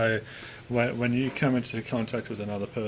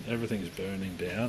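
normal talking voice

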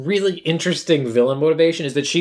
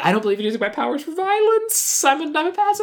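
high, small goody-goody voice; sweetly refuse, way up high; high timid voice; 12 semitones higher than usual. Retold or imitated. Layla quoted refusing to fight.

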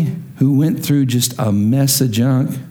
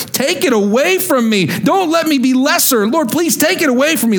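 high-pitched, insistent plea to God; urgent, rising entreaty; pleading prayer; 10 semitones higher than usual. Acted. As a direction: beg for it to be taken away. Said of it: "I pleaded with the Lord".

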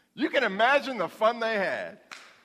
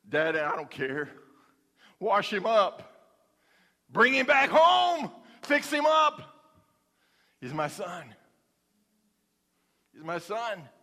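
father's plea; 4 dB louder, slower than usual. Acted. Father's voice, climax then tender.

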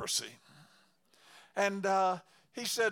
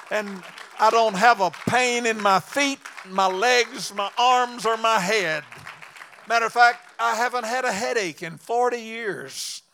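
preaching at full force; 8 dB louder than usual. Acted. Preacher testifies to the congregation about his healing, loud and fervent. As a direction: proclaim it to the back pews.

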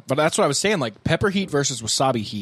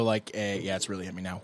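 normal talking voice

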